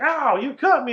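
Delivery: mock whiny Jack. Mocking Jack whining at Wendy about the knife, high hurt yelp. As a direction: squeal it like he's shocked she'd dare.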